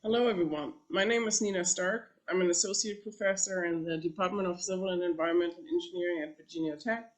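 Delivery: ordinary delivery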